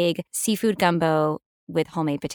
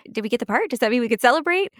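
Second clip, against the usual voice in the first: put-on higher-pitched eager child asking; excited kid; 6 semitones higher, 3 dB louder, faster than usual. Actress recalling her child self asking producers, excited kid. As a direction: lightly imitate a hopeful, excited kid. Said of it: "I was a kid, you know? I was like".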